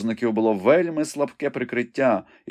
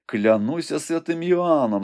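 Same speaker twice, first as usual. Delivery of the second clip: admiring; 6 semitones higher, slower than usual. A narrator's reading. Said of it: "захоплено промовив Айвенго".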